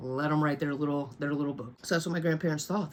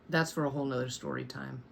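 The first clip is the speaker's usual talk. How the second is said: ordinary delivery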